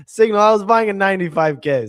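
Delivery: high, loud, through laughter; laughing confession. Real, not acted. Owning up to co-hosts about a bad buy, laughing. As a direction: laugh out your own bad trade. Laughs at own badly timed buying.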